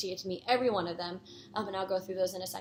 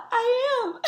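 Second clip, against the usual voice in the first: high, loud, through giggles and tears; can barely get it out for laughing; laughing while quoting; 8 dB louder than usual. Real, not acted. Vlogger retelling the singer's reply, cracking up. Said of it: "she goes".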